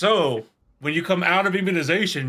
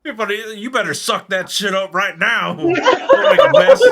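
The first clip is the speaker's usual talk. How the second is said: mock drill sergeant dissolving into laughter, much higher; laughing mock sergeant; 6 semitones higher, 7 dB louder than usual. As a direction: bark it as a sergeant but crack up.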